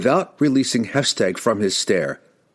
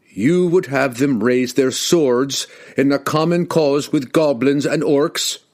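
slow, raised, accusing voice; 6 semitones higher, 3 dB louder than usual. Acted. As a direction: condemn him before the crowd. Wulfgar accuses king before crowd.

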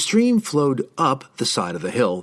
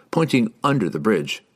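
plain reading voice

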